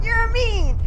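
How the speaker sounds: high, very loud accusation; upset yell. Real, not acted. Protesting friend's raid in game.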